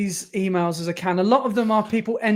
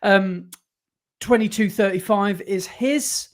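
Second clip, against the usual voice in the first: ordinary talk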